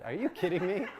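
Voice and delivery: stunned, half laughing; incredulous through a laugh; laughing disbelief. Retold or imitated. Told it was only warm-up.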